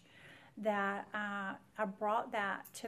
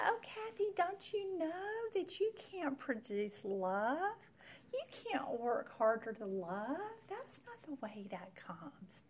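gentle mentor; 6 semitones higher than usual. Retold or imitated. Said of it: "so gently".

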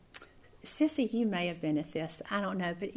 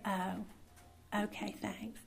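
much softer, sheepish; deflated; 11 dB quieter, much slower than usual. Retold or imitated. Giving in to her husband's point, sheepish and soft. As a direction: concede it meekly.

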